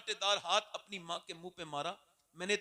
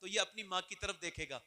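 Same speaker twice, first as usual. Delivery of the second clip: ordinary talk, nothing marked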